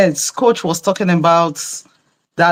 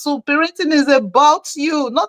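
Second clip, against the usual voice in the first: high, emphatic retold lecture; insistent, high-pitched admonishing; exasperated scolding; 9 semitones higher than usual. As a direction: tell off a parent in a raised pitch. Scolding the father to calm down.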